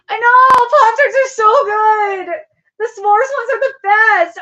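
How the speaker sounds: hammed-up tearful shout, high and loud; fake-sob about how good they are; mock crying over Pop-Tarts. To chat, craving Pop-Tarts, mock-wailing loudly.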